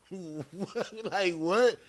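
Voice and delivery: how could he know my brother?; incredulous shock. Retold or imitated. Retelling his stunned reaction to an inmate, disbelieving.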